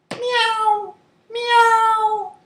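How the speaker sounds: very high, drawn-out mews; cat meow. Shown as a demonstration. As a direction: mew like a cat. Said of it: "I will make the sound".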